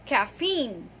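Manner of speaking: "caffeine" as ka-FEEN, said slowly; "caffeine" ending in a falling "feen"; correct pronunciation. Shown as a demonstration. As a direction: stretch the long E in caffeine. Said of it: "It has long E sound".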